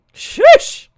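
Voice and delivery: very high loud burst; sudden excited yell; hype shout. Real, not acted. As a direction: blurt it out big. Hype outburst.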